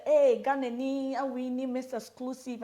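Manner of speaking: calling out. Retold or imitated. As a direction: call out across the room. Imitating people calling out.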